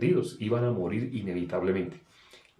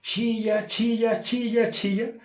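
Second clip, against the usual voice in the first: much higher, slow squealing pig voice; imitating a squealing pig; 12 semitones higher, 4 dB louder, slower than usual. Preacher mimics the pig squealing at the slaughterhouse. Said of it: "por tanto que grita y grita".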